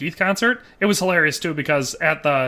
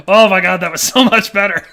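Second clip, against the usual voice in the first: excited outburst; 8 dB louder than usual. Retold or imitated. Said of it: "I was like".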